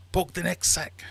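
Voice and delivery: high, loud command; soldier's order. Acted. German ordering the Irishman's sack poked, high loud voice.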